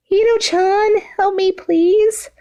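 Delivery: put-on high, slow whining girly voice; drawn-out high pleading girl; whiny plea. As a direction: beg like a spoiled child. Toga voiced in read story.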